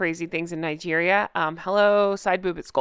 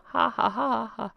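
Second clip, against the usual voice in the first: mock laugh; 6 dB quieter than usual.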